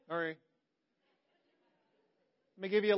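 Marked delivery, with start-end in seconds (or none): 0.1-0.4 put-on sullen teen mumble. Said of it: "like a thirteen-year-old".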